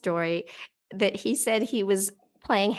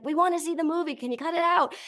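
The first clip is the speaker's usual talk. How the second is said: high-pitched imitated complaint; annoyed audience; 8 semitones higher, faster than usual. Audience asking a noisy viewer to stop, high mock annoyance. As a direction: plead high, as a shushing moviegoer. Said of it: "everybody was shushing me".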